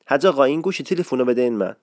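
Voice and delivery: حج آقا said quickly and clipped short, fast; کوتاه و سریع; quick short address. Shown as a demonstration. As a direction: rattle off حج آقا without stretching it.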